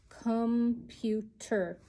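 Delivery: "Computer" drawn out slowly, syllable by syllable; slow dictation. Shown as a demonstration. Teacher dictating a word to her pupils, slowly. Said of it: "The next word is computer".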